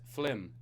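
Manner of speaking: "flim" said with the l before the i; "flim" as Singaporeans say it, not film; "flim" with the vowel after the l. Real, not acted. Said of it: "I say flim because I was raised in Singapore".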